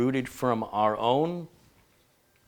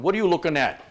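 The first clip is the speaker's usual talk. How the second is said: loud, higher-pitched Brooklyn challenge; Brooklyn accent; 7 semitones higher, 6 dB louder, faster than usual. Acted. Streamer greets a viewer watching from Brooklyn, mock Brooklyn accent. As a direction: throw it out like a mock-confrontational Brooklyn local. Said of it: "he's watching us from Brooklyn".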